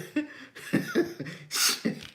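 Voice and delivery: high, airy helpless laugh; real laughter. Real, not acted. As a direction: crack up, unable to finish. Cracks up over the suggestion.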